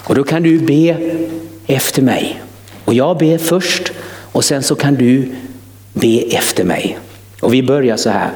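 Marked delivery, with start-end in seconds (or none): none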